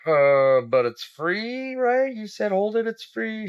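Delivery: high, incredulous pleading; mock protest. Dad to peddler demanding money, high-pitched protest.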